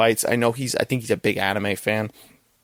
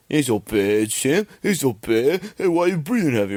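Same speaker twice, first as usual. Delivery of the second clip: higher-pitched mock boxer impression; taunting fighter; 4 semitones higher than usual. Fighter goading his rival in the ring, mock taunting. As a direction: put on a goading movie-boxer voice, pitch jumping up. Acting out Rocky taunting Mister T.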